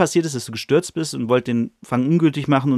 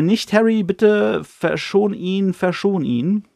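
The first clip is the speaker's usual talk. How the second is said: begging woman; 7 semitones higher, much slower than usual. Retold or imitated. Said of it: "er hört nochmal".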